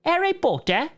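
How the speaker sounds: high, hammed-up British "Harry Potter"; a mock posh English schoolboy; exaggerated British accent. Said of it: "That's more of Australian".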